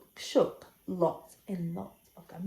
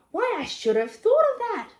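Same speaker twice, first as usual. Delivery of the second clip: high, loud delighted exclamation; excited dingo; 10 semitones higher, 6 dB louder than usual. A narrator's reading. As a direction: burst out with delight.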